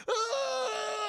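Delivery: high, far-off agonized yell; pained scream. Retold or imitated. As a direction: cry out as the hand explodes. Victim hit by sniper bullet.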